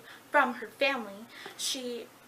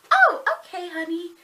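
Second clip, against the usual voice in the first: high, loud start, then dropping; mock motherly reply, pitched up; placated mom; 5 semitones higher, 9 dB louder than usual. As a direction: imitate a mom breezily accepting it. Imitated mom accepts the excuse.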